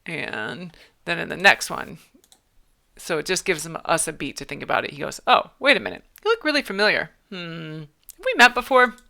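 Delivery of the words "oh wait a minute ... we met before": suspicious recognition. Acted. Character voicing dialogue aloud.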